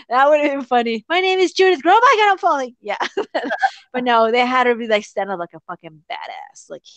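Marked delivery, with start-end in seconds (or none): 1.1-2.7 high-pitched mock introduction tipping into panic; Judith. Fan joking about Judith falling, high hammed-up panicky voice. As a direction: squeak the grand intro, then wail as if toppling.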